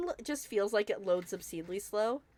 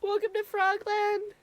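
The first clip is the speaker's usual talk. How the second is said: excited squeal; 10 semitones higher, 5 dB louder, much slower than usual. Real, not acted. Delighted at new building.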